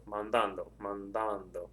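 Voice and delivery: "mandando" with the stress on the middle "dan"; stress on second A. Shown as a demonstration. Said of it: "The stress is over the second A".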